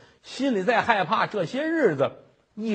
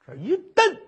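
ordinary delivery

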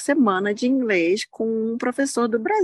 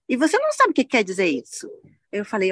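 ordinary talk, nothing marked

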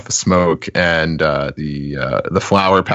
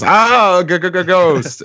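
much higher, louder stammered scream; screaming ghost; 11 semitones higher, 5 dB louder than usual. Acted. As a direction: shriek and stutter in terror. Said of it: "screaming, crying, laughing".

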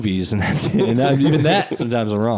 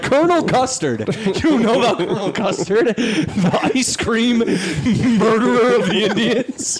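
dumb American voice; 8 semitones higher than usual. Retold or imitated.

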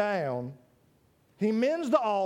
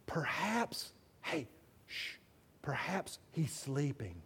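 very soft, low and slow, with a shush; hushed mocking; 8 semitones lower, 11 dB quieter, slower than usual. Elijah teasing that their god is sleeping, mock hush. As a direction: hush them as if someone's asleep.

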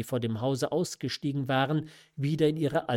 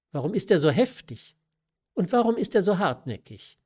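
higher and fast, pressing questions; mother's reproach; 6 semitones higher, 5 dB louder, faster than usual. A narrator's reading. Mother reproaches her daughter, quick and raised. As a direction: demand it briskly.

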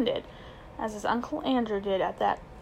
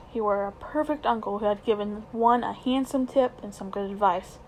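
ordinary delivery

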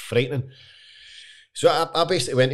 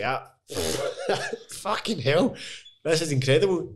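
amazed laugh; 4 semitones higher, slower than usual. Retold or imitated. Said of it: "I was just like".

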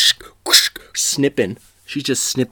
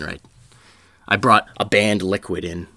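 ordinary delivery

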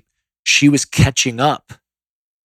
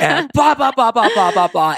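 mock ranting; 12 semitones higher, 4 dB louder than usual. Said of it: "I three more times said".

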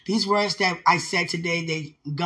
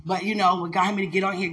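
ordinary talk, nothing marked

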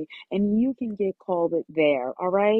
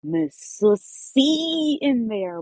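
drawn out; much slower than usual. Shown as a demonstration. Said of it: "with the slow roll".

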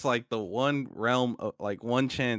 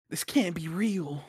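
slow, gushing anime character; anime fan voice; 6 semitones higher, slower than usual. Pretend. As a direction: gush like a starstruck anime kid. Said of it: "doing the anime, hands over the eyes".

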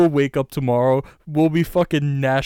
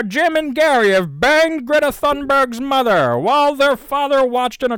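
higher, louder hammed-up anchor voice; mock newscaster; 9 semitones higher, 4 dB louder than usual. Joking about their story making the news, hammed-up anchor. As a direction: announce it like breaking news.

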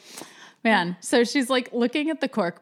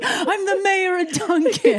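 hammed-up Boston accent, much higher and louder, slow; Casey Affleck Boston accent; 12 semitones higher, 5 dB louder than usual. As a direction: overdo a Boston accent, laughing through it. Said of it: "I think of a Boston accent".

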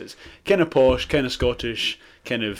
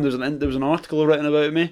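ordinary delivery

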